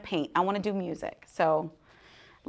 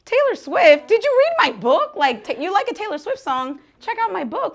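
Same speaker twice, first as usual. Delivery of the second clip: much higher, loud fangirl pitch; excited call-out; 10 semitones higher, 7 dB louder than usual. Pretend. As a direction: call out to your idol. Calling out to [name].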